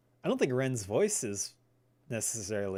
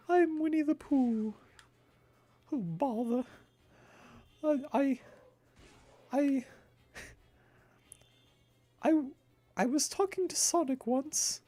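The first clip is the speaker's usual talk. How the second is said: very high, gentle cartoon bear; halting falsetto bear impression, far above the usual voice; Winnie the Pooh; 13 semitones higher than usual. Gamer attempts a requested impression, high falsetto cartoon bear. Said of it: "Can you do a Winnie the Pooh impression?".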